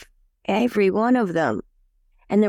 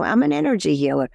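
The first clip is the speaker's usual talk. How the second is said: ordinary talk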